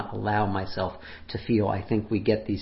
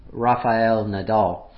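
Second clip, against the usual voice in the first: usual speaking voice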